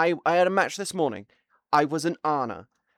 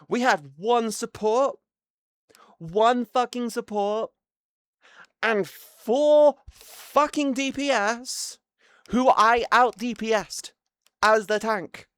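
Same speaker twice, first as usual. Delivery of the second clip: building angry rant, higher and slower on fucking DPS; ranting anger; 6 semitones higher, slower than usual. Real, not acted. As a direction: work yourself up about the useless team.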